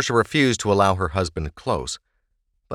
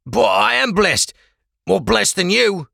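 moaning; 12 semitones higher, 5 dB louder than usual. Acted. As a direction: moan it out in desperation. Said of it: "Ulrich moaned".

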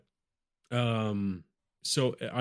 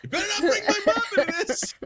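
high yelling dog voice; 7 dB louder, faster than usual. Pretend. Voicing the barking dog.

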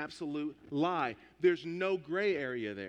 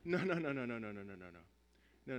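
dismissive no's; 7 semitones lower, 7 dB quieter, faster than usual. Retold or imitated. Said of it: "the player looks at the ref and says".

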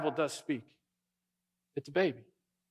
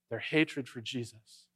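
slow and softer, "Their hatred for Jesus" said low and grave; hushed emphasis; 5 semitones lower, 7 dB quieter than usual. Real, not acted. Preacher revealing what unites the rival groups, hushed and slow. Preacher repeats key phrase.

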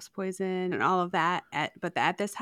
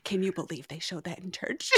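mom's hushed outrage; 3 semitones lower, 7 dB quieter than usual. Retold or imitated. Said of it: "And she was like".

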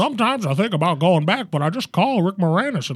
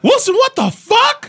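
high, loud outraged yell; shocked shout; 5 dB louder than usual. Pretend. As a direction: scream his name in disbelief. Acted walk-in, shocked friend.

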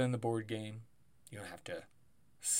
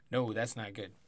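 ordinary talk, nothing marked

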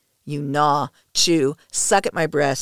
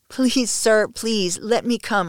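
higher-pitched, urgent plea; pleading cry; 6 semitones higher, slower than usual. A narrator's reading. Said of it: "I cry".

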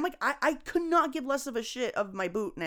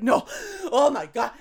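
retold panic; 3 semitones higher, 7 dB louder than usual. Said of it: "hyperventilated and freaked the fuck out".

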